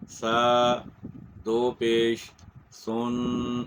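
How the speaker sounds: سا, then دو پیش سن drawn out very slowly, ending on a nasal n; spelling out tanween. Shown as a demonstration. A teacher spells tanween very slowly for students. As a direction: stretch the spelling out for learners. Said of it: "تو ہم کہیں گے".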